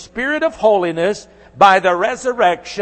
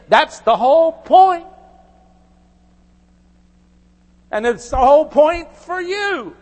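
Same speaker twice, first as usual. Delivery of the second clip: raised, higher-pitched preaching push; 9 semitones higher, 5 dB louder than usual. Acted. Pastor pressing the doctrine of resurrection on listeners, preaching hard. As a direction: drive the point home to the congregation.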